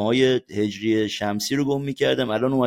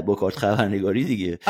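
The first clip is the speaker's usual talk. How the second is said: ordinary talk, nothing marked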